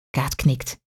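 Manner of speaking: ordinary delivery, nothing marked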